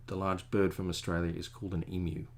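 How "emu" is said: emu with a y-glide, EE-myoo; Australian pronunciation. Shown as a demonstration. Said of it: "I insist that the".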